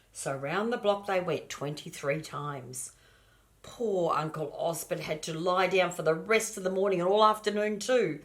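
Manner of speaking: ordinary delivery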